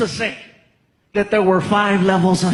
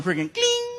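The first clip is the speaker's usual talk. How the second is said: text chime; 13 semitones higher, 4 dB quieter than usual. Retold or imitated. Imitating phone text alert.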